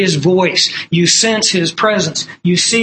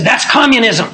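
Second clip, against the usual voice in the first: sharp raised accusing snap; retold angry outburst; 4 semitones higher, 3 dB louder than usual. Heckler in Bible study, a raised indignant accusation. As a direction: throw it back at him, indignant. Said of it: "practically frothing at the mouth".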